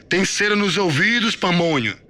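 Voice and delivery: high, loud taunting insult; scornful drill-sergeant bellow; mocking sergeant yell. A narrator's reading. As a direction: jeer at the recruit at full voice. Sergeant mocks recruit.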